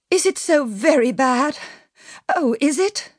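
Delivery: high, anxious pleading question; imploring. A narrator's reading. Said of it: "lifted imploringly".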